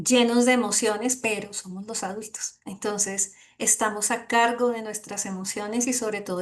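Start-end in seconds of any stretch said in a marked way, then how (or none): none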